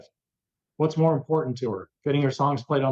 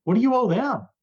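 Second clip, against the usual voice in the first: indignant retort; 5 semitones higher than usual. Retold or imitated. Arguing back at angry bosses.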